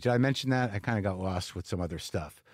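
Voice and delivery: ordinary talk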